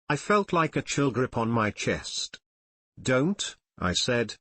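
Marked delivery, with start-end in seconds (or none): none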